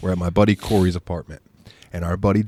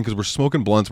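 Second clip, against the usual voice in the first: normal talking voice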